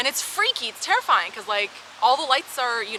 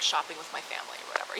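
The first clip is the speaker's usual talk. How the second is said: whispered brush-off; 5 semitones lower, 8 dB quieter, faster than usual. Retold or imitated. Polite brush-off to creepy guy.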